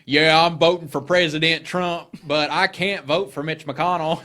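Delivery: imitating Southern accent. Friend retelling a campaign ad, mock Southern accent. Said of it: "the most fucking Southern accent".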